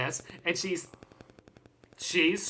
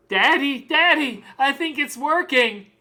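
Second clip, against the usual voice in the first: put-on high, louder child voice; excited little girl; 6 semitones higher, 3 dB louder than usual. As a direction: call out to Daddy in delighted discovery. Excited daughter voiced in story.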